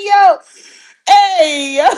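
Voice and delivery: normal talking voice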